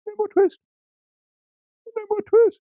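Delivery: very high, loud squeaky shout; twittering shout. A narrator's reading. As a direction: shriek it as tiny mice. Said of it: "shout at once in little twittering voices".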